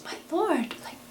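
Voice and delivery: high, hushed, quickly puzzled disbelief; surprised. A narrator's reading. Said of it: "She's very surprised".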